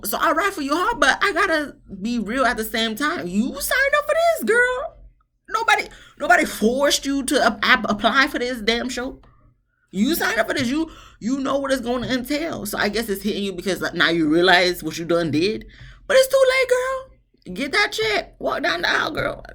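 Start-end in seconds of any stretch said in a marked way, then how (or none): 0.0-12.7 put-on much higher, laughing girl-you-signed-up; sassy call-out. Lecturing the bride on screen. 16.1-19.4 put-on much higher, laughing girl-you-signed-up; sassy call-out. Urging the bride down aisle.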